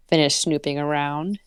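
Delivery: usual speaking voice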